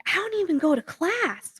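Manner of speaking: high mocking voice of the student; mocking quote. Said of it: "she's like".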